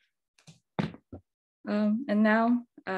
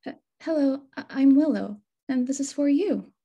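nervous stammer; 5 semitones higher, 5 dB louder than usual. Acted. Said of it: "In a quivering voice".

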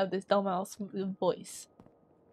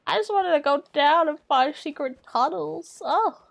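whiny complaint; 5 semitones higher, 6 dB louder than usual. Real, not acted. Whining about blocked path.

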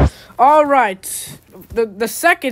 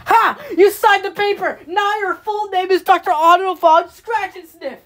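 high, loud gotcha gloat; triumphant gloating; 8 semitones higher, 3 dB louder than usual. Acted. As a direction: gloat over the trick.

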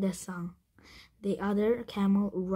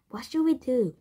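loud, high-pitched panicked question; alarmed cry; 5 semitones higher, 7 dB louder than usual. A narrator's reading. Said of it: "cried Jack".

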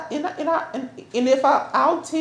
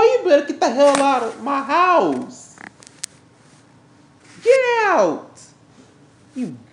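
yelling him out. Acted. Woman throwing the man out of her house, furious yelling. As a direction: drive him out the door with every word.

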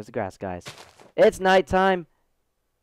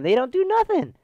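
very high, loud voice; comic squeal; 13 semitones higher, 5 dB louder than usual. Pretend. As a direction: push it up into falsetto. Comic claim about spiders.

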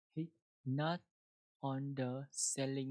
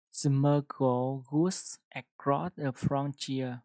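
slow model reading; 7 dB louder, much slower than usual. Shown as a demonstration. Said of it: "teacher reading example slowly".

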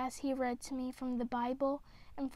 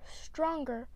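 ordinary delivery, nothing marked

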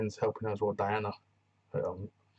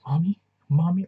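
high, loud panicked cries of "Mommy"; imitating the singer crying out for her mom; mock panicked calling; 7 semitones higher, 11 dB louder than usual. Singer breaking down on set, hammed-up loud panicked calling. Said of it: "She calls for her mom".

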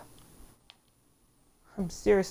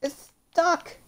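sudden high-pitched cry of frustration; high frustrated squeal; 11 semitones higher, 5 dB louder than usual. Real, not acted. Player yelping at the game that he's stuck, high squeal. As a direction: squeak it out in disbelief.

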